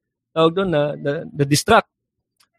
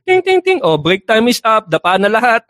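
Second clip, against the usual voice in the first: high, louder bell sound then call; bell and announcement; 7 semitones higher, 3 dB louder than usual. Retold or imitated. Demon in hell announcing break over to people.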